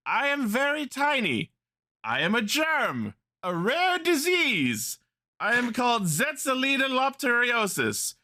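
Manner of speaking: high, slow, grand tiny-germ voice; cute germ voice. Retold or imitated. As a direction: proclaim it like a pompous wizard. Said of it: "that's from fucking Sword in the Stone".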